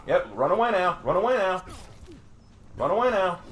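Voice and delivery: taunting. Real, not acted. Taunting a fleeing opponent.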